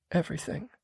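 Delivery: quiet regretful apology; contrite woman. A narrator's reading. Female character.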